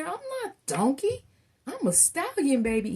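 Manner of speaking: high, loud, cocky character voice; boastful stallion. Acted. Transformed Donkey correcting Shrek, proudly boasting. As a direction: crow it with hammed-up swagger.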